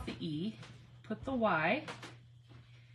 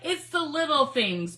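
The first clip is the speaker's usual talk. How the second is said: slow, loud and high delight; slow loud delighted declaration; excited; 6 semitones higher, 9 dB louder, slower than usual. Real, not acted. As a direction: savour the joy. Delighted exclamation.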